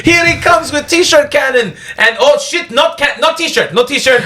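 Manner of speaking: high, drawn-out stadium call; high, slow announcer voice. Pretend. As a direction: hype it up like an arena announcer. Mock play-by-play of a cannon.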